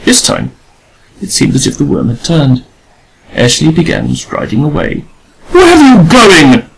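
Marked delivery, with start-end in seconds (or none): 5.5-6.7 high, loud crying scream. A narrator's reading. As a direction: shriek after the departing man. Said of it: "screamed Adela".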